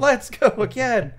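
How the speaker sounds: hammed-up keen repeat; imitating Mako's actress insisting on another take; eager actor, pushed harder. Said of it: "get back and be like".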